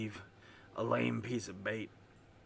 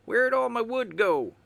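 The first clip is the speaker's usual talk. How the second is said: loud; 11 semitones higher, 8 dB louder than usual. Acted. Calling out, upset.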